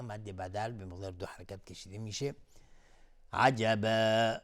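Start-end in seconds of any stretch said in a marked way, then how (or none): 3.3-4.4 slow, loud «عجبا» with a long final aa; substitute madd. Shown as a demonstration. Teacher shows madd replacing tanween, final aa of «عجبا» lengthened. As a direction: draw out the last aa of «عجبا» in place of the tanween. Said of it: "مد عوض".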